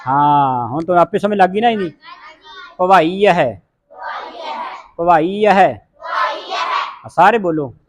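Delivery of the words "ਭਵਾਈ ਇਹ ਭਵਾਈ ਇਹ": ਭਵਾਈ ਇਹ as "pavaa-ee-aheh"; sounded aira. Shown as a demonstration. Teacher drills the class, ਭਵਾਈ ਇਹ with every syllable sounded. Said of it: "ਐੜੇ ਦੀ ਆਵਾਜ਼ ਉਚਾਰਨ ਕਰਨੀ".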